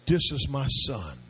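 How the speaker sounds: very low, slow divine pronouncement; God speaking, solemn and deliberate; voice from heaven. Acted. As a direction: sink deep and stretch out the words. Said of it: "And then the voice says".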